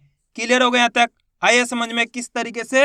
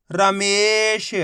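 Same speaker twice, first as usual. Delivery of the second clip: drawn-out word; 2 semitones lower, much slower than usual. Shown as a demonstration. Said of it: "आपको मेरे साथ साथ इनको रिपीट करना है".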